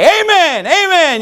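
high, excited amens called out; excited church shout. Acted. Preacher imitating his excited church, high exultant shouting. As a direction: cry amen like a fired-up congregation. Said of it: "get excited at church and say".

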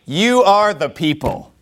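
slow, drawn-out mock praise; exaggerated, deliberate flattery; sarcastic. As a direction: stretch each word as a mocking compliment. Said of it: "how Job's using sarcasm".

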